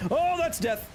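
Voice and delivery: sudden high, mock-alarmed exclamation; high-pitched cry. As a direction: squeal it way up high. High cry at game mishap.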